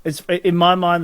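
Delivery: usual speaking voice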